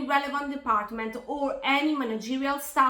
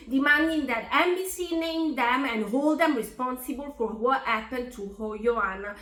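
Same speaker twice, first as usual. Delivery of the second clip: usual speaking voice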